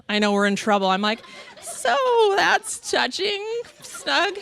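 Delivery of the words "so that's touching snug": high, slow, laughing strain; strained politeness. Retold or imitated. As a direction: squeeze the words out slowly up high. Squeezing into too-small pajamas.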